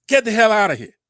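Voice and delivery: loud, high burst of outrage; angry dismissal. Real, not acted. Speaker rebuffing those who accuse his side of violence, angry.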